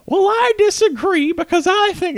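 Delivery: high, insistent voice of a contrary lady; mock contrary woman.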